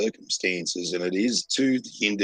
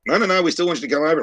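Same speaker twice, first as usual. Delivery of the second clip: high, fast, louder reassuring rush; mock eager employer; 10 semitones higher, 6 dB louder, much faster than usual. Camp bosses insisting he still come, fast mock eager. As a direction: hurry to reassure him. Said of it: "they're like".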